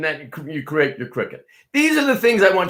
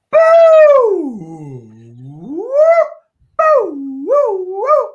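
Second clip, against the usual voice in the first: high, loud boo and woo calls; boo woo warm-up; 7 dB louder, much slower than usual. Shown as a demonstration. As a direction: belt the boos and woos up high. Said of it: "I think it's gonna be".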